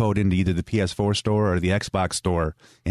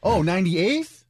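put-on high, eager child asking if he won; kid nephew voice; 7 semitones higher than usual. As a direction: play the excited nine-year-old nephew. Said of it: "That's me being your".

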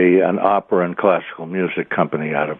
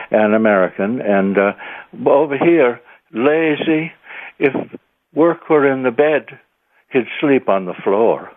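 higher-pitched Irish cop telling a joke; 5 semitones higher than usual. Retold or imitated. Irish cop grumbling about his lazy nephew, higher voice. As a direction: retell it as the cop, pausing before the punchline. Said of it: "So there was a big Irish cop there".

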